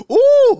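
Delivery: very high, loud "ooh"; imitated cameraman's whoop. Retelling how the cameraman reacted to the smack, imitated whoop. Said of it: "the cameraman went".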